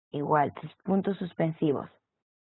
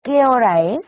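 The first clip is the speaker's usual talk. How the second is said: ¿Qué hora es? asked louder and higher with a clear question lilt; 4 semitones higher, 8 dB louder than usual. Shown as a demonstration. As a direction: ask it like a real question. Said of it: "un matiz de pregunta".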